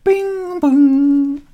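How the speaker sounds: high sung bing bong chime; chime sound. Acted. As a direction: sing the two-tone ship chime. GM voices ship chime.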